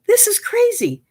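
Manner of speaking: very high outraged disbelief; astonished high squeak. Retold or imitated. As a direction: let it fly up in shock. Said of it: "And I thought".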